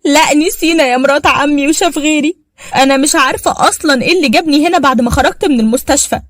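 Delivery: the wife raging to her mother-in-law, furious. Acted. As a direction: spit out the hurt at the mother-in-law. Furious wife demands divorce.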